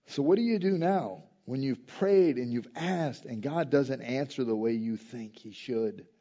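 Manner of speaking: ordinary talk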